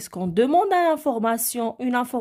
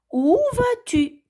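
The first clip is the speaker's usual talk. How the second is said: où vas-tu said higher and slowly as a question; stress on où vas-tu; 4 dB louder than usual. Shown as a demonstration. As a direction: lift où vas-tu high and slow. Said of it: "je sais très bien".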